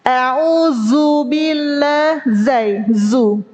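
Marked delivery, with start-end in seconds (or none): 0.1-2.2 A'udzu billah, slow, with a buzzing "z": auzubillah; dhal as zai. Shown as a demonstration. Teacher imitates the common wrong zai reading. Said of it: "Atau seringnya jadi zai".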